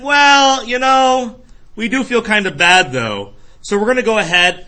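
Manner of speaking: mock shout. America voiced, shouting.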